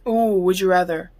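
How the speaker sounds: usual speaking voice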